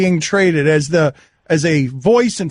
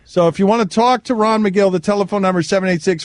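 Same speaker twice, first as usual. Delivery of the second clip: normal talking voice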